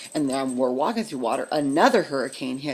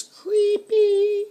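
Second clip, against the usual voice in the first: high, drawn-out eerie sing-song; loud, high creepy lilt; 8 dB louder than usual. Real, not acted. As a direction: stretch the word out high and spooky. Describing the dark silent streets.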